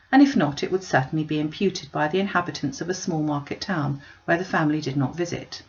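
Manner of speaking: plain reading voice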